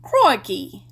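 loud British exclamation crikey; British accent. Shown as a demonstration. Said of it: "they do say crikey in the U K".

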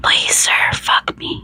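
hushed, whispery desperate plea; desperate begging. A narrator's reading. Narrator voices the begging character, desperate and whispered. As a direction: plead softly, almost all breath. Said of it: "my voice shaky with desperation".

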